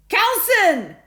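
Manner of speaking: very loud, high shout. A narrator's reading. Bartholomew shouting after Carlson in the corridor, loud. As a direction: call him back sharply. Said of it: "shouted Bartholomew".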